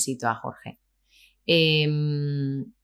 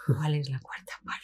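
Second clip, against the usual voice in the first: hushed, puzzled question to oneself; baffled undertone; 7 dB quieter than usual. Retold or imitated. Novice actress wondering at casting jargon, hushed. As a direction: murmur it under your breath, lost. Retelling private confusion on set.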